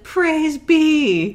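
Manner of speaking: high, loud mock rejoicing; exultant cry. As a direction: call it out in hammed-up joy. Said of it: "Charles yells, "The mill is running," and Doc is like".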